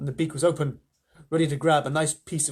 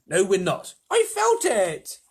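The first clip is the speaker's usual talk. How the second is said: raised, high calling voices; excited arguing; 5 semitones higher, 5 dB louder, slower than usual. A narrator's reading. Crew disputing whether peach lifts, excited shouting. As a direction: shout the reply, stretching the end.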